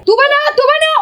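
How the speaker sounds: bright sing-song coaxing; playful high call. Real, not acted.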